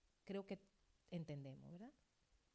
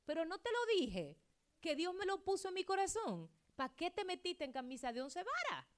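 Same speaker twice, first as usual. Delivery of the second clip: higher, louder scolding; scolding rebuke; 8 semitones higher, 6 dB louder than usual. Retold or imitated. Pastor scolding a member who ignored her warning, loud and high. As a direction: scold them for not listening. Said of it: "que yo le digo".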